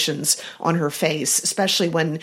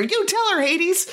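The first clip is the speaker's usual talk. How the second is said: high gleeful cheer; 12 semitones higher than usual. Retold or imitated. Podcast host reading her notes, cheering Hades on, high. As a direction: egg him on from the sidelines. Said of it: "I have notes here".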